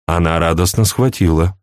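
usual speaking voice

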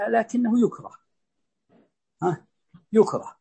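ordinary delivery

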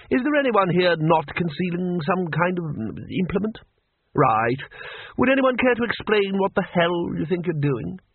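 high-pitched, commanding Arch-Chancellor; exasperated Ridcully. Acted. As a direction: demand an explanation from errant subordinates.

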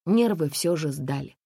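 plain reading voice

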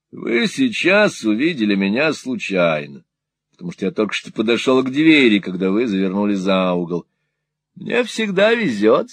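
simper it like a cringing usurer; fawning old moneylender. Acted. Gride voiced in Nickleby reading.

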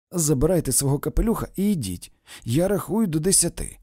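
loud, high, quick order; stern ultimatum. A narrator's reading. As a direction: send someone off sharply. Helen sends him away with ultimatum. (суворий ультиматум)

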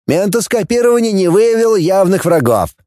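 high, formal report voice; reporting security chief. A narrator's reading. Security chief briefs the ship commanders, high formal voice. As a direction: report to the assembled officers.

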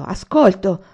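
sudden loud cry; shout. A narrator's reading. Anania starts and shouts back at Olì, sudden cry. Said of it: "trasalì e gridò".

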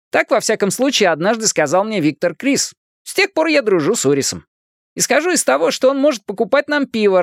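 put-on high-pitched motormouth kid; wisecracking boy, chattering. A narrator's reading. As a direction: rattle on cheekily in a high voice. Narrator plays wisecracking Richie.

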